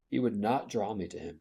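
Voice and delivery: ordinary delivery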